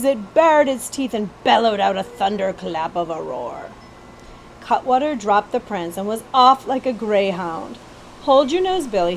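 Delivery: usual speaking voice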